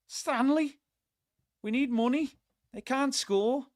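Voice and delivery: lightly voiced pleading mate; light impression of Josh reporting back; mimicking Josh. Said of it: "Not that he's got a Scouse accent".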